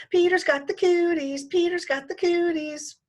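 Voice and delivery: put-on high, sing-song taunting girl; mock yell. A narrator's reading. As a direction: tease him in a shrill playground chant. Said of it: "she yells".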